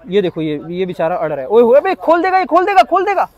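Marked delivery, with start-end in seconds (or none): 1.5-3.3 higher and louder, startled that the calf will break loose; sudden alarm at the tugging calf; alarmed exclamation. Real, not acted. Animal pulling loose, alarmed. (ऊँची घबराई आवाज़ में)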